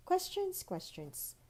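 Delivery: high sing-song first, dropping to a sigh; weary fairy sigh. Acted. Busy fairy to curious Holly, exasperated. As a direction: roll eyes at so many questions.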